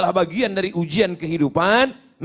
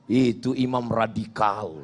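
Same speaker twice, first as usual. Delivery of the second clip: low, soft aside; people's gossip; 10 semitones lower, 5 dB quieter than usual. Acted. People commenting on the imam, low soft remark. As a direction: say it low, as a quiet verdict. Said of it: "apa kata orang".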